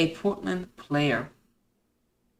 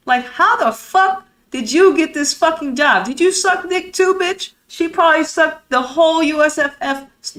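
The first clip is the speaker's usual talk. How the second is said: higher, louder furious outburst; real angry rant; 7 semitones higher, 5 dB louder than usual. Real, not acted. Ranting at league officials, real furious outburst. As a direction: lose your temper at the officials. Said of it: "let me stop my shit".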